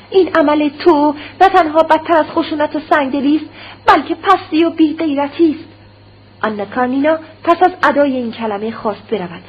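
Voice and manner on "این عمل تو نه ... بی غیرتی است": high and loud, spitting the insult; wife hurling contempt at her husband; angry outcry. Acted. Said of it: "آنا کارنینا فریاد برآورد". (فریاد تحقیرآمیز و خشمگین)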